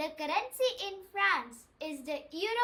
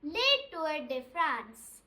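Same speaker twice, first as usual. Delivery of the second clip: "Le Tour de France" spoken slowly, every syllable clear; slow it right down so they can copy it; slow model phrase; slower than usual. Shown as a demonstration. Teacher prompting learners to repeat, slow and clear. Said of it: "Say it".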